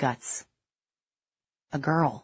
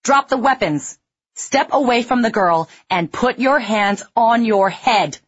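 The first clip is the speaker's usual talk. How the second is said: loud, high commanding shout; lieutenant shouting orders at attackers; 7 semitones higher, 5 dB louder than usual. A narrator's reading. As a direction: order them down hard. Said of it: "I shouted".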